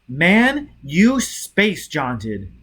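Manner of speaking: savage shouted accusation. A narrator's reading. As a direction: spit it out savagely at him. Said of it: "Sheffield said savagely".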